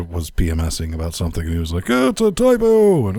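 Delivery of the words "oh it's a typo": high, loud retold outburst; mock exclamation. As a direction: re-enact someone crowing over a mistake. Retold gleeful pointing out of typo.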